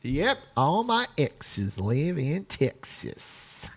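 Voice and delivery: slow, soft Texas drawl; Texas accent. Acted. Host answers co-host about Texas, slow put-on Texas accent. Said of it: "she's more of the Texas accent".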